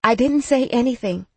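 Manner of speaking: ordinary delivery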